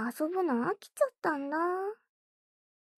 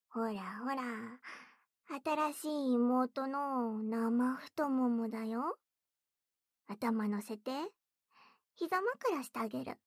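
soft coaxing; 7 dB quieter than usual. Acted. Soft lap pillow offer.

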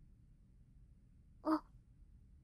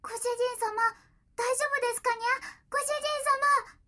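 worried; 8 semitones higher, faster than usual. Acted. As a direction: call out to him in alarm. Cat worries master is sick.

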